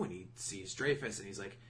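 normal talking voice

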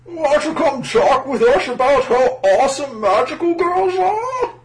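very high, loud squeaky voice; high goon voice. Acted. Goon voice in dub.

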